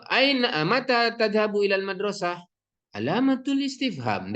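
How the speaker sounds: "Aina, mata tadhabu ilal madrasah? Alamatul istifham" high throughout, the mark's name voiced like dictation; mock dictation of "Aina, mata tadhabu ilal madrasah? Alamatul istifham", pitched high; punctuation read aloud. Shown as a demonstration. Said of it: "Kita tidak katakan misalnya". ("Aina, mata tadhabu ilal madrasah? Alamatul istifham" dibaca tinggi seperti mendikte)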